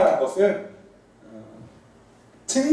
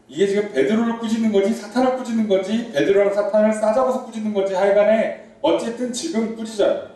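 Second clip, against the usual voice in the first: normal talking voice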